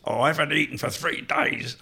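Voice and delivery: high-pitched put-on barfly voice; Ian Board. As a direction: imitate an outrageous alcoholic landlord. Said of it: "the alcoholic who ran the pub".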